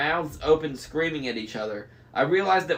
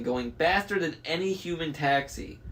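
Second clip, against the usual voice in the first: plain reading voice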